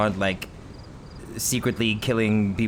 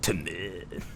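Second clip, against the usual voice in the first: put-on Japanese accent; 4 semitones lower, 10 dB quieter than usual. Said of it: "Japanese accent".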